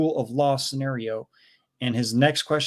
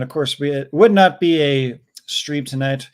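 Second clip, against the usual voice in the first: usual speaking voice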